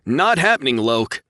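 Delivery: high, loud firm refusal; flat refusal. A narrator's reading. Pilot refusing co-pilot, loud. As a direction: shut it down sharply. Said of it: "Josh insisted".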